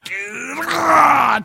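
gear-change roar rising high; mock engine roar; imitated engine noise. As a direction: mimic a straining car engine climbing up. Imitating his car changing gears.

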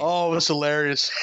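usual speaking voice

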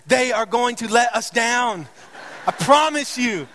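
high, slow, hammered-out prediction; emphatic warning. Real, not acted. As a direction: preach the doom of the team word by word. Mock warning to sports fans.

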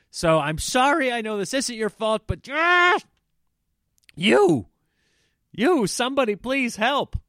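high, loud plea for help; venting frustration, bursting into loud yelps; exasperated outburst. Acted. Passenger venting at the ticket counter, exasperated and loud.

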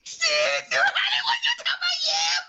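very high falsetto whoop; delighted squeal. Real, not acted. Host flattered by a young age guess, falsetto squeal. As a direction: squeal with over-the-top delight.

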